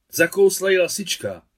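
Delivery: loud, high burst of bad news; alarmed news. Aunt rushing in with a dead hen, loud alarm.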